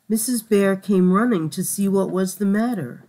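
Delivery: ordinary delivery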